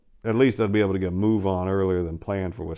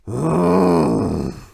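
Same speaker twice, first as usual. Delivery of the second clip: long resigned sigh falling away; defeated exhale at a wasted Friday night; weary sigh; 6 semitones higher, 4 dB louder than usual. A narrator's reading. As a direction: let out a sigh of giving up. Narrator stuck driving deadbeat around.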